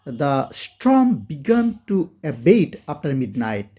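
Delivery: each word of the storm began to abate said very slowly, with storm stressed; slow model reading. Shown as a demonstration. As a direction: read it out word by word for learners. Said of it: "তো আমরা এই বাক্যটা পড়ি". (ধীরে ধীরে স্পষ্ট করে পড়া)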